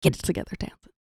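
whispered pep talk to herself. Retold or imitated. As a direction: hiss a quiet self-reminder. Self pep talk, hushed.

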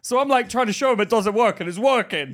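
loud, high-pitched retelling; worked-up exclamation. Retold or imitated. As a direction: exclaim it over the others in exasperation. Worked up, showing machine now works.

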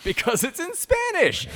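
obvious punchline. Real, not acted.

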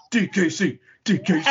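high, punchy mock chant; imitated overexcited commentary chant; excited fan commentator. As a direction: chant it like a commentator losing his mind. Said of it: "he's saying in the commentary area".

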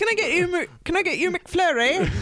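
put-on a try at an Irish accent, high, that comes out British; a McDonald's order at the drive-through in a failed Irish accent. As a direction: attempt Irish, slide into British. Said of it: "Why can't I do an Irish accent?".

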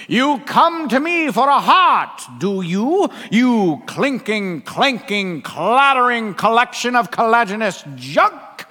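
high, loud, slow theatrical bellow; screaming wizard. Acted. Wizard berating the Tin Man, booming scream. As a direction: bellow it grandly, stretching the insults. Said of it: "he screams at them one at a time".

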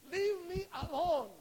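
loud, high, drawn-out protest; acted exasperated shout; irritated outburst. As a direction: push them away with force. Acts Thomas snapping back.

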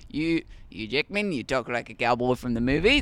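light Aussie lilt rising at the end; understated Australian accent. Shown as a demonstration. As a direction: ease in through the name, then lilt it in Australian. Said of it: "that one didn't hit that hard".

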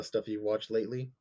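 usual speaking voice

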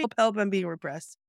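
ordinary delivery

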